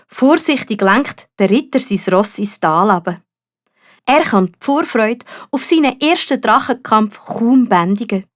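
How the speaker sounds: ordinary delivery, nothing marked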